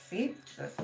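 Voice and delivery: usual speaking voice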